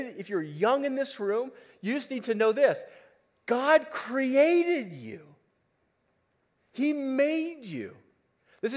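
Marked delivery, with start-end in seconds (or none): 3.5-5.3 God created you drawn out slow and high, rising on created; slow emphasis. Acted. Preacher telling the young ones in the room, slow and emphatic. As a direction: lean hard on created, very slowly.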